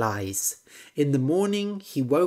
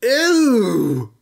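loud, higher ew; frog disgusted; 7 semitones higher, 9 dB louder than usual. A narrator's reading. Frog recoiling at chicken's worm, loud ew. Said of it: "said the frog".

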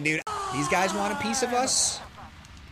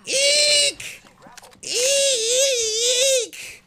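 high girlish mock eek, over and over; mock squeal; 13 semitones higher, much slower than usual. Streamer squealing in mock fright at an enemy. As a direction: squeal like a startled cartoon lady.

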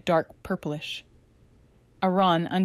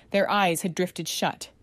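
plain reading voice

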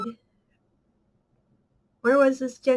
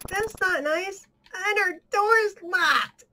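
whiny; 7 semitones higher, slower than usual. Pretend. Game character locked out.

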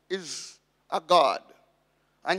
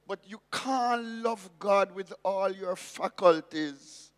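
usual speaking voice

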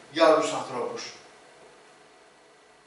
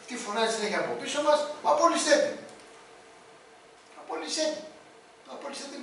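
irritated complaint; 7 semitones higher than usual. Acted. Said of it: "λέει".

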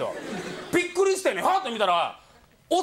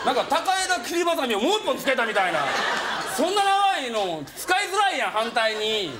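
excited; 5 semitones higher than usual. Retold or imitated. Friend raving about Inoki, higher, laughing. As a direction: get carried away telling it.

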